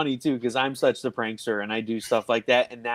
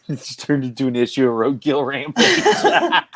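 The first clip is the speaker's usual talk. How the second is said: louder, laughter running through the words; laughing; 3 semitones higher, 6 dB louder than usual. Real, not acted. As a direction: talk through real laughter. Cracking up at the stories.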